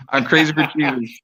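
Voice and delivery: real laugh running into the words; amused, say it through a laugh. Real, not acted. Testifier happily agrees with the host, laughing. Laughs while echoing host's summary.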